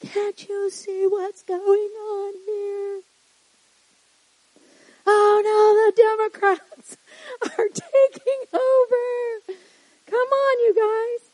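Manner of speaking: high, drawn-out whiny wail; mock panicked complaint. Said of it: "We have all our ways of saying that".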